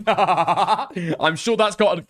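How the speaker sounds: high, slow words through laughter; real helpless laugh, loud and mocking; laughing sarcasm. Real, not acted. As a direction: crack up while saying it. Cracking up, sarcastic about Jeremy.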